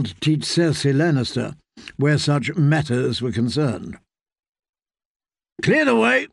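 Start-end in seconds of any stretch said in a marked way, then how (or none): 5.6-6.3 shouting knight. Acted. Knight shouting ahead of the Queen's litter. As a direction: bellow it over the crowd. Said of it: "Sir Osmond Kettleblack was shouting".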